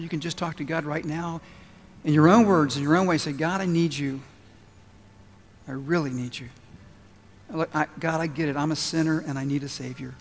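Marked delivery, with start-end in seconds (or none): 3.3-10.1 low, soft prayer of repentance; modelled prayer. Acted. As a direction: lead them softly through a humble confession to God.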